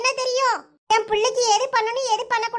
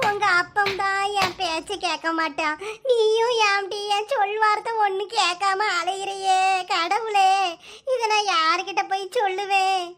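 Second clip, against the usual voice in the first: usual speaking voice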